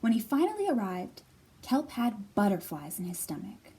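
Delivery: storybook read-aloud, gentle and even; reading to children. A narrator's reading. Librarian reading a picture book to children. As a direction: tell it like bedtime story time.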